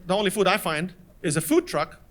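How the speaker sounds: ordinary delivery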